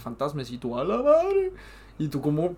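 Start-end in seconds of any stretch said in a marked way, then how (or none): 0.8-1.5 high, loud "A la madre" in mock shock; startled cry. Player faces a real ghost in the game, high startled yelp. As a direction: yelp it like you just saw a ghost.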